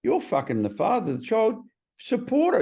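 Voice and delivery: sponsor's telling-off, climbing high; retold scolding. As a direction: imitate an exasperated rebuke. Sponsor scolding him in retelling.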